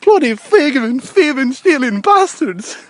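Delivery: high, loud mock Irish rant; hammed-up high Irish accent; Irish guy impression. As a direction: rail at thieves in a put-on Irish voice. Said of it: "the Irish guy".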